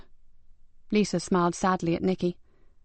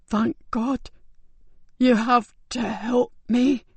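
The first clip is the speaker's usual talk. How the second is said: higher, slow, strained dying voice; weak dying man; 4 semitones higher, 3 dB louder, much slower than usual. Acted. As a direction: barely hold on to each word.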